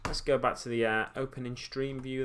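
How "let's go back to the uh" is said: ordinary talk, nothing marked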